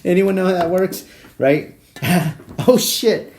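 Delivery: high, loud words squeezed through laughter; cracking up. Real, not acted. Comedian asking listeners about liens, cracking up. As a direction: talk while helpless with laughter.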